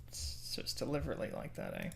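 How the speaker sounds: whispered aside to self. Real, not acted. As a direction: whisper it as a realisation. Muttering to self.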